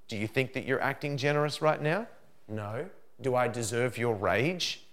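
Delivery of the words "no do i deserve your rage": ordinary talk, nothing marked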